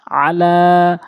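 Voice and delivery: আলা with short আ and long held লা; recited আলা, last vowel drawn out; one-alif elongation on লা. Shown as a demonstration. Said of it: "মদের হরকত".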